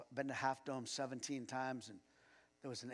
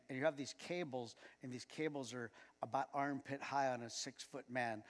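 usual speaking voice

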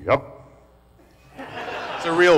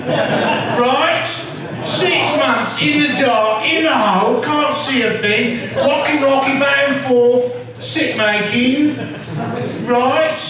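high-pitched, drawn-out Cockney demo; hammed-up high Cockney storytelling; Cockney accent; 7 semitones higher, slower than usual. Shown as a demonstration. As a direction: pitch the Cockney up and roll through the misery. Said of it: "Can you do a Cockney?".

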